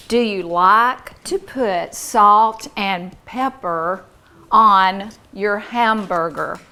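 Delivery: slow, loud, carefully spaced words; model sentence. Shown as a demonstration. Teacher giving a class a sentence to hear, slow and clear. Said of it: "I'm going to say a sentence".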